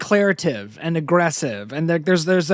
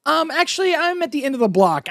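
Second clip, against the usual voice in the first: fake-casual brush-off; 10 semitones higher, faster than usual. Woman deflecting a creepy driver.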